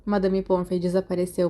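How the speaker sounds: plain reading voice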